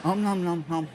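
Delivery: soft sleepy munching; eating in sleep. Retold or imitated. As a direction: mumble like chewing while asleep. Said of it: "he does this".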